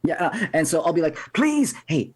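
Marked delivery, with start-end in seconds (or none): 1.3-2.1 high put-on plea; mimicked pleading voice. Disguised player calls to the approaching halfling, high put-on plea. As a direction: plead in a voice pitched well up. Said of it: "Now I'll change my voice again".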